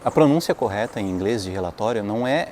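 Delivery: ordinary talk, nothing marked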